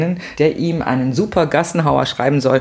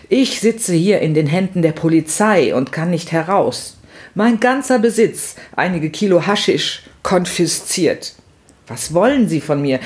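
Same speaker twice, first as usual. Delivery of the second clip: higher, declaimed plea from a jailed friend; theatrical lament of a wronged prisoner; Mehmet's plaintive letter; 4 semitones higher than usual. Acted. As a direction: plead with pathos, drawing out the poetic lines. Reads Mehmet's pleading letter as him.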